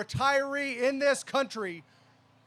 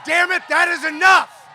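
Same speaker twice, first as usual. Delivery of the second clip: loud, high shouted cry; full rally shout; 5 semitones higher, 11 dB louder than usual. Real, not acted. Union leader closing his speech, shouting at full force. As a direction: yell it with everything. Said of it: "I'm here to say".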